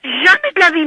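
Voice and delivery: high, loud, insistent denial; real indignant protest. Real, not acted. Woman refusing a wake-up call she never ordered, indignant protest. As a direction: protest loudly, pitch pushed right up.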